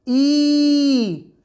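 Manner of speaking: ई held long; long ī demo. Shown as a demonstration. Teacher demonstrating the long ī sound to the class. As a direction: stretch ई into a long ī. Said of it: "तो आवाज़ बन जाएगी".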